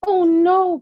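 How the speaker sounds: put-on high, upset little-girl cry; a child's sudden dismay; cried out. A narrator's reading. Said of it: "Grace cried".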